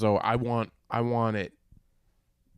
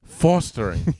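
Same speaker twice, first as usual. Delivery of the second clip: FOSTERING pitched up with a Long Island "aw"; Long Island accent; 5 semitones higher, 4 dB louder, slower than usual. Shown as a demonstration. Trying the friend's correction in a Long Island accent. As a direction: exaggerate a Long Island "FAW-stering". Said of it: "just a Long Island accent".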